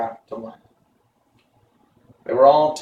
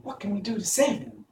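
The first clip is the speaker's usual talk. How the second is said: put-on girl's voice, pitched much higher; a young girl, higher and earnest; Dorothy voice; 10 semitones higher, 3 dB quieter than usual. A narrator's reading. Dorothy to friends on the riverbank, higher and earnest. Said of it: "asked Dorothy".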